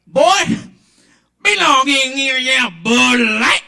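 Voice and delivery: put-on high, loud Southern good-old-boy twang; slow, loud, hammed-up Southern drawl. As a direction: drawl like a Southern grandpa at the bar. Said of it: "love the twang of the voice".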